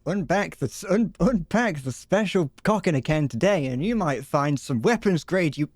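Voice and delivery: hammed-up commercial voiceover; mock ad read. A streamer pitching a fake canned product, mock ad voice. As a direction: sell the product like a cheesy TV advert.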